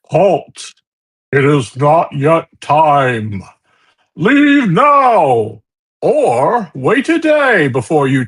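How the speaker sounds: loud, slow, commanding voice from above; booming voice. Acted. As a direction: boom it out like an unseen guardian. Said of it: "a booming voice addresses you".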